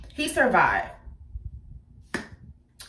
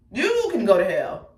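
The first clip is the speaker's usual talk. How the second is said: ordinary delivery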